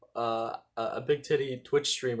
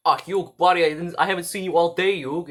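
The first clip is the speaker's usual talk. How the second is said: put-on loud, higher New York-esque accent; Joey Wheeler; 5 semitones higher, 8 dB louder than usual. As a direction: call out to a buddy in a cartoon New Jersey accent. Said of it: "I go around doing my best Joey impression".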